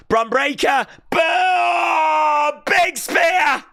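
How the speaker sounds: building to a big shout; wrestling commentator. Real, not acted. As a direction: call the move at full volume. Calling the spear live.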